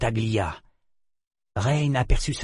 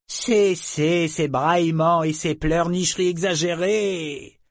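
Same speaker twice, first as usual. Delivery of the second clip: higher, slow, swooping reprimand; scornful dragon; 6 semitones higher, slower than usual. A narrator's reading. Dragon orders the humans to stop wailing, scornful. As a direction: scold them grandly, dragging out the words.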